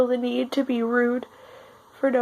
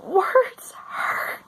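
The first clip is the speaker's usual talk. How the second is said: tearful, breaking whisper; crying whisper; 9 semitones higher, much slower than usual. Real, not acted. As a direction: whisper it through tears. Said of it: "I didn't think I was gonna cry".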